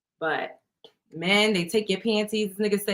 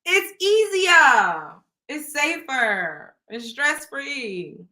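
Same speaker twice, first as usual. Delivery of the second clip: slow drawn-out; 4 semitones higher, 3 dB louder, much slower than usual. Acted. Slow drawn-out list of reasons.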